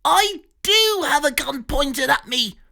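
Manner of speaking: high outraged exclamation; Pig exclaims. Acted. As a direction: shout it high in alarm. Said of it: "Pig exclaimed".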